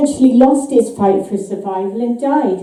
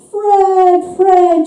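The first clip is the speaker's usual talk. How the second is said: high, eerie, drawn-out calling of Fred; a dead wife's voice luring him from beyond; ghostly wife calling; 6 semitones higher than usual. A narrator's reading. The haunting wife calls her husband's name, high and eerie. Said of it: "she cries again".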